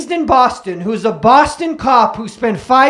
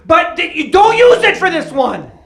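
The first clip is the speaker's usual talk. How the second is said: usual speaking voice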